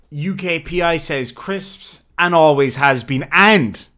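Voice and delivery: usual speaking voice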